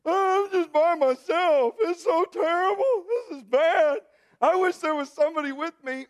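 high, loud, slow whiny laugh-cry; hammed-up self-pity with laughing sobs; whining alone in the trial; mock belly aching. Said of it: "level of my belly aching".

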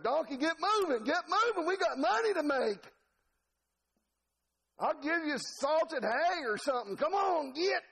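high-pitched country rant; exasperated goading. Acted. Preacher as Balaam urging donkey to move, exasperated. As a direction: holler at a stubborn animal, hammed up.

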